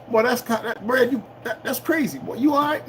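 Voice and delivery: a hint of a concerned friend checking on him; imitating doubters. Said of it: "people will sit there going".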